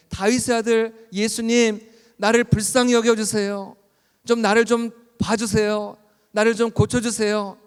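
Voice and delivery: acted shout for mercy; crying out. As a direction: call out beseechingly over a crowd. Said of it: "막 소리를 지르는 거예요".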